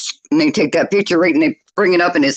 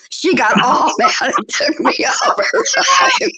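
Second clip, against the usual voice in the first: laughing hard; slower than usual. Real, not acted. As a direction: laugh through the line. Laughing while telling it.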